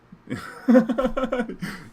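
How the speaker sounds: a high burst of laughter. Real, not acted. Laughing at his turn-one Cage off a mulligan. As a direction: let a big high laugh break out.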